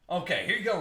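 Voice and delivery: ordinary talk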